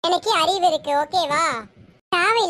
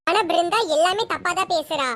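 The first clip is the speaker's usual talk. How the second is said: ordinary talk, nothing marked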